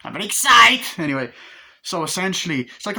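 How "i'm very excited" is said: loud, high burst of excitement; hyper, raised-voice outburst; very excited. Real, not acted. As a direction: shout out how excited you are. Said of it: "That's why I'm excited".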